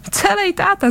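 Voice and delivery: high-pitched gloating jab; gleeful malice. A narrator's reading. Big man mocks a boy's father, high and gloating. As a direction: tease with spiteful glee, pitch jumping up. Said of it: "zasmál se škodolibě Lestro".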